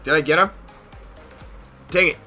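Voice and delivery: ordinary talk, nothing marked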